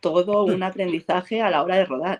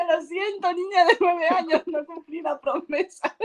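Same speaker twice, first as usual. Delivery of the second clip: high, laughing mock apology to her child self; 11 semitones higher than usual. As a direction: apologise to your nine-year-old self, tongue in cheek. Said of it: "Y yo". (disculpa exagerada y aguda a la niña que fue)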